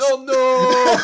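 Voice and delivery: high, loud "no" stretched out; mock cry of protest. Reacting to the Num Num skit, hammed-up loud cry. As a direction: wail it out loud for laughs. Crying out, laughing about skit.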